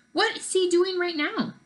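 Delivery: high, eager curious ask; excited girl asking about the invisible friend; eager question. Acted. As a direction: lean in, dying to know. Marisol eagerly asks.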